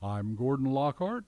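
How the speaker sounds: ordinary talk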